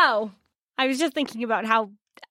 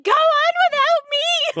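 melodramatic sacrifice; 5 dB louder, slower than usual. Pretend. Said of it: "I'm just back there being like".

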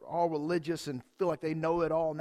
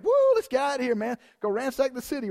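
high, loud rowdy cheer; mock jailbreak whoop; 5 semitones higher, 6 dB louder, faster than usual. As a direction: whoop like escaping convicts. Imagined prisoners celebrating jailbreak.

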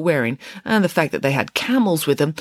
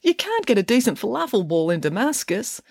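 high-pitched whining protest; plaintive, petulant grumble; complaining; 8 semitones higher than usual. A narrator's reading. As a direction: whinge like disappointed children. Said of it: "complained the kings".

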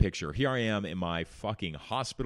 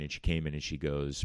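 normal talking voice

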